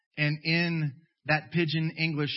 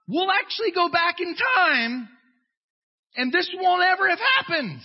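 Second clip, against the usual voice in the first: high, loud over-the-top frenzy; hammed-up mask zealot; 11 semitones higher, 5 dB louder than usual. Mocking mask zealots in a high, frantic shout. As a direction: shriek it like a wild-eyed zealot. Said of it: "other people are like".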